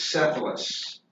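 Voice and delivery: Cephalus said softly as SEF-uh-lus; pronunciation demo. Shown as a demonstration. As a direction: model Cephalus with an S sound at the start. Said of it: "you pronounce that Cephalus".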